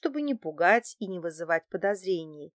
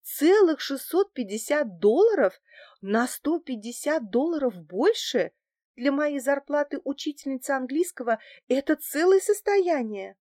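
high, marvelling repetition of the sum; amazed delight; 6 semitones higher, 4 dB louder than usual. A narrator's reading. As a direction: marvel at it as if at a fortune. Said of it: "восхитилась я". (восхищённо, высоко, с изумлением)

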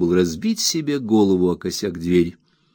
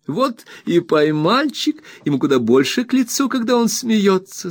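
coaxing wife; 11 semitones higher than usual. A narrator's reading. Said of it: "сказала она".

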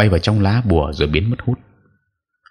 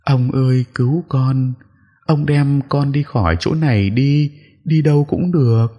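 high pleading ghost, a touch of sobbing; a weeping ghost begs the exorcist to take her away; sniffling plea; 8 semitones higher than usual. Acted. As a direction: beg the old man, mildly tearful. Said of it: "Con ma vẫn thút thít".

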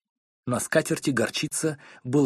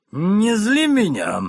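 slow, much higher and louder bark; 4 semitones higher, 4 dB louder, much slower than usual. Acted. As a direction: snap at her to stop provoking. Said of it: "рявкнул мистер Корнер". (медленно и громко рявкает)

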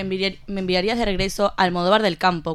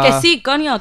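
louder, higher, fed-up yes; exasperated snap; 5 semitones higher, 5 dB louder than usual. Acted. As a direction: bite it out in exasperation. Exasperated insistence.

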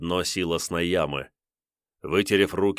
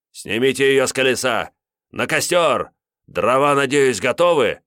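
louder, higher barked orders; loud command; 5 semitones higher, 6 dB louder than usual. Acted. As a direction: order the pyre prepared. Priest orders the pyre.

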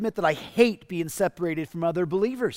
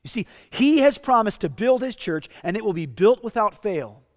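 louder, higher, insistent proclamation; preaching loudly; 4 semitones higher, 6 dB louder than usual. Real, not acted. Pastor assuring the listeners the church will be built, loud and insistent. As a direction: drive the promise home.

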